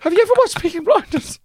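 loud squeaky mock desperation; panicked suggestion. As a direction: pitch it sky-high, pretending to scramble for a way out. Frantically redirecting pupils from African accent.

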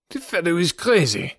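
high, protesting character voice; indignant Count. Acted. Count dismisses his accuser to the onlookers, indignant.

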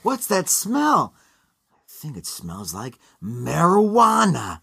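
drawn-out cartoon chipmunk voice, swooping in pitch; Simon from the Chipmunks. Quoting a cartoon short to the co-host, mock chipmunk voice. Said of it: "from the Chipmunks in that chipmunk voice".

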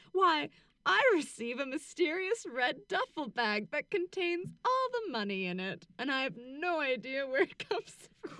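high, put-on voice of feigned bafflement; mock innocence. Mocking the defendant's excuse on stand.

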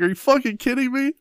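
high-pitched retold disbelief; mock incredulous. Husband replays his reaction to wife's fart, mock incredulous. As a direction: question her in high, amused disbelief.

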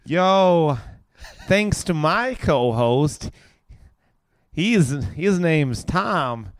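slow, high mock American drawl; American accent. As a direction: ham up an American host voice, stretched out. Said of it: "do your best American accent".

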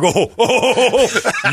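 high, slow laughing oh-oh-oh; mock laughing oh. Friends mocking the haircut, with a high laughing oh. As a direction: imitate friends cracking up at him.

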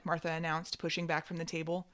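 ordinary delivery

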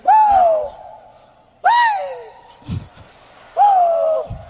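high, loud imitated "woo" cries; mock hollering. Comic mocks a woman hollering in bed, high loud whoops.

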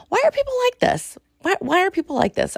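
high, fast exasperated wail; exasperated squeal. Real, not acted. Host fed up with online hate, high fast wail. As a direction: squeal it out in disbelief.

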